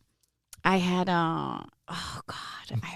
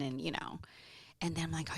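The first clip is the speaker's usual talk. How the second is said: usual speaking voice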